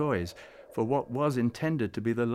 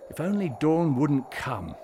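louder, yearning wish; fervent wish in a raised voice; 3 semitones higher, 5 dB louder than usual. A narrator's reading. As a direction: plead for it not to happen. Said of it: "Klaus wished".

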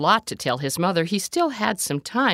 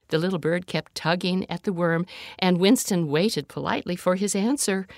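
plain reading voice

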